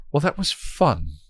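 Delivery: high, bored scornful taunt. Acted. Hell god dismissing the knives, taunting. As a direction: toss it off like a yawn. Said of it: "Glory taunted".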